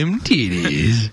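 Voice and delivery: slow lecherous echo; slow leering drawl. Pretend. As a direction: drag it out with a leer. Echoes the leering line.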